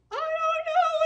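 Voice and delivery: very high, loud, wailing; crying old lady. Retold or imitated. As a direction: wail it out like an upset old lady. Crying resident voiced.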